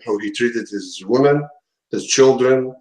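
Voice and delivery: usual speaking voice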